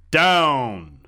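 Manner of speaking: long, loud, falling shout; booming. A narrator's reading. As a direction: bellow the order, stretching the word. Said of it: "a deep voice boomed".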